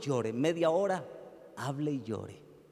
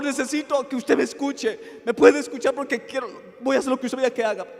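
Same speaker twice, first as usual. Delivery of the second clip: much higher and louder, a frantic tearful plea; desperate crying plea; 12 semitones higher, 11 dB louder than usual. Retold or imitated. Distraught woman on the phone begging pastor, high frantic plea. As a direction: beg the pastor for help as if sobbing in despair. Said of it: "Cuando me llamó llorando, en un desespero".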